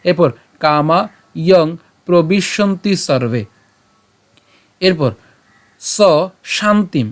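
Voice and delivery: slow verse reading; slow recitation. Shown as a demonstration. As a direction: read slowly, word by word. (ধীরে শ্লোক পাঠ)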